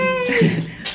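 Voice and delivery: high cackling witch laugh. Pretend. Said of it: "mi risa de bruja".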